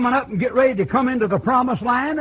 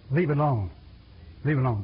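ordinary talk

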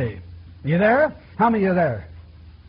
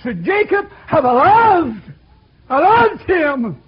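higher, louder, slowed cry; preaching climax; 8 semitones higher, 6 dB louder, slower than usual. Real, not acted. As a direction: peak the sermon on "loved".

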